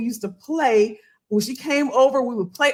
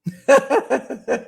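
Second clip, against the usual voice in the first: laugh; 2 semitones higher, 4 dB louder than usual. Real, not acted. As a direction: burst of amused chuckles. Laughs at audience comments.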